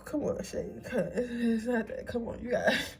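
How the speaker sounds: spent, tearful plea to herself; trying to stop real tears, voice giving out; crying. Real, not acted. Reader telling herself to come on, crying. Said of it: "I have never cried during a tarot reading".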